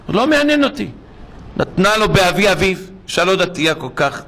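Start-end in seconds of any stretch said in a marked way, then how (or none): 0.1-0.9 high-pitched, curt refusal; dismissive rebuff. Retold or imitated. Son to the nurse about the last respects, dismissive rebuff. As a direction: act out a son brushing it off.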